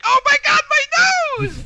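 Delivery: very high, loud wailing shout; mock panic. Kids catching a cold and fearing for the nose, mock panic. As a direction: shriek it like a hysterical child, hammed up.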